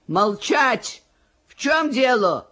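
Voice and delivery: Russian officer's order. Acted. Police officer intervenes in Russian.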